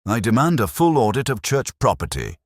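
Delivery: indignant cry. A narrator's reading. Committee president demanding an audit before townspeople, indignant cry. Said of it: "she cried".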